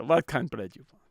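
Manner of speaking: put-on grumpy Dracula-like Hungarian accent. Grumpy owner asking customer about bread, Dracula accent. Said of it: "the real Dracula accent".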